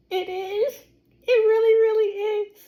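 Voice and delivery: high, tearful, overcome with joy; near tears. Real, not acted. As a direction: choke up over how good it is. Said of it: "I'm almost in tears".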